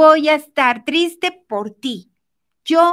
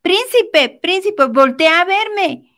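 princess shouting for attention, raised and louder; calling out; 4 semitones higher, 5 dB louder, slower than usual. Acted. Princess in the tower hailing a passing prince, calling out. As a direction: call out insistently, in a higher voice. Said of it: "Empieza a gritarle y decir".

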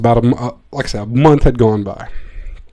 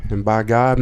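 usual speaking voice

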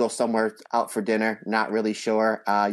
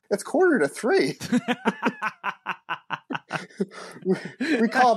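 laughing; 13 semitones higher than usual. Retold or imitated. Friend answers, laughing retelling.

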